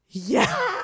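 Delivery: high, eager yes; an eager yeah to Jackson; excited yeah. Retold or imitated. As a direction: pounce on the answer gleefully. Eager answer to his question.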